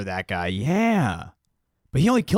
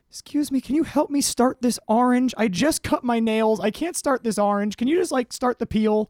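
high, whiny Chris Farley voice; Chris Farley impression; 13 semitones higher than usual. Retelling Chris Farley asking for help at the mall, high and whiny. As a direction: beg a stranger, much higher. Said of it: "Chris Farley skits".

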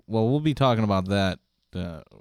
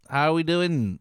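higher Aussie "How we doing?" with a mate's lilt; mock Australian accent; 5 semitones higher than usual.

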